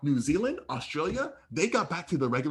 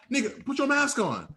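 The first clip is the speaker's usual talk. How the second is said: high-pitched, insistent retold demand; acted exasperated order; retold confrontation; 10 semitones higher than usual. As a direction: replay telling off a stranger, pitched up. Retold bus confrontation over a mask.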